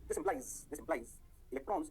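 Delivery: slow, low, measured note dictation. Real, not acted. Teacher dictating electron notes to students, slow dictation. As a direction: dictate slowly in short chunks with long pauses.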